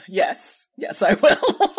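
breaking into laughter while insisting; a giggly, playful yes I will; laughing insistence. Retold or imitated.

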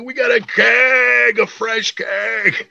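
high, drawn-out whoop of excitement; Wally. Retold or imitated. Excited friend driving away with a keg, elated mock holler. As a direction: imitate a pal freaking out, stretching the words. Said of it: "Wally was so excited".

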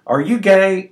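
high, blunt shouted question; shouting. A narrator's reading. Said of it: "only spoke in one tone, shouting".

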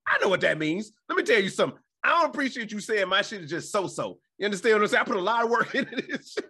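high, loud comic indignation; hammed-up outrage tipping into a laugh; mock mad. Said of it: "I remember I used to get mad".

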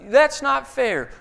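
slow, high, loud whiny echo; hammed-up childish whine; mock whine. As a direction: parrot the whine back at the kid. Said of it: "just say what these things back to your kids".

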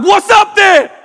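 very high, loud retold yell; challenging shout. Speaker challenging the man leaving the car, high shout. Acts out confronting other driver.

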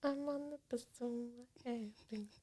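soft breathy whisper echo. Pretend. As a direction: whisper it back like a secret.

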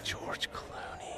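slow, unvoiced mock-appalled whisper; whispered disbelief. Host repeating co-host's Batman answer, whispered disbelief. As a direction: whisper the name as if scandalized.

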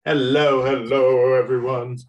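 booming hello, hello; loud greeting. Acted. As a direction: call out a big hello.

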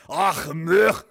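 guttural Scottish ach; mock Scottish. Shown as a demonstration. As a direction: clear the throat like a Scotsman. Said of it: "is that how you guys talk in Scot".

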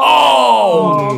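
high shouted gasp of shock; a mock-dramatic oh.

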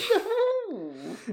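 high laughing witch cackle; shrill mock cackle. Witch cackle after gingerbread line.

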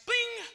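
very high ringing "bing"; ping like an incoming message; imitated text alert. Shown as a demonstration.

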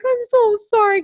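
high, loud, near-tearful sorry; apologizing to the hurt cat, high and tearful; tearful apology. Retold or imitated. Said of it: "I started crying".

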